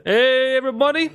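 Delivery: cheery greeting. Real, not acted. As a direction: greet the audience with a big upbeat hello. Cheery intro greeting to viewers.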